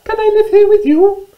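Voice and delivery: very high, squeaky mouse voice; little mouse. Acted. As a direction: squeak it out like a tiny storybook mouse. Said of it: "she said".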